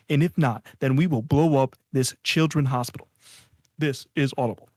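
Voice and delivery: loud, menacing narrator read; Audible narrator voice. Pretend. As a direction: read a ransom note like an audiobook. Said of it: "the guy from Audible".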